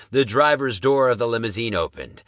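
ordinary delivery, nothing marked

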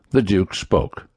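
ordinary delivery

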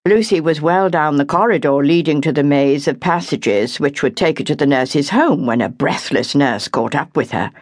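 ordinary delivery, nothing marked

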